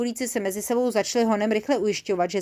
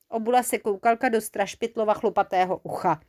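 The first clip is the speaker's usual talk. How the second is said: plain reading voice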